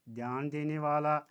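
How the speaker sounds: ordinary talk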